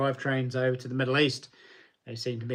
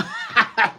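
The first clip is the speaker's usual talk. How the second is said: laugh; 8 semitones higher, 6 dB louder than usual. Real, not acted. Laughing at chat's joke.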